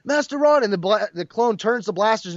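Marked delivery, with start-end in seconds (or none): 0.0-0.6 high, louder cry of a name; anguished cry. Retold or imitated. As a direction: call out in despair. Crying out for fallen master.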